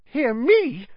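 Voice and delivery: squeaky-high panicked disbelief; very high, incredulous question; unnaturally high voice. A narrator's reading. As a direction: let panic push the voice way up. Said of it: "in an unnaturally high voice".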